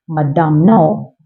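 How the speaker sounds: ordinary delivery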